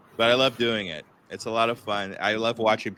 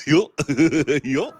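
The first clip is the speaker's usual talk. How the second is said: Goofy voice; 5 semitones higher, 3 dB louder than usual. Shown as a demonstration. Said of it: "I could do, uh, Goofy".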